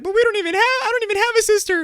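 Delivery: put-on very high, loud whiny kid voice; protesting kid. Kid objects to babysitting, high loud mock whine. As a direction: squeal the protest like a little kid. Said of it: "And he'd be like".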